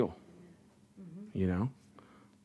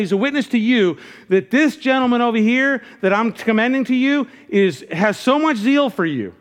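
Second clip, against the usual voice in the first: higher, louder, driving pulpit delivery; preaching at full force; 8 semitones higher, 5 dB louder than usual. Acted. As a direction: preach it out to the whole room. Preaching at full force, raised pitch.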